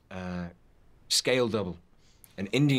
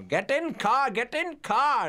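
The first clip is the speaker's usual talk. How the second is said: bossy shout; slower than usual. Retold or imitated. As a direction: bark the order at the actor like a mother hen. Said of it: "B K shouting at Viggo".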